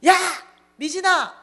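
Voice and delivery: high, loud hail; acted-out bossy call. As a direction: shout after a friend to come back. Friend shouts after her.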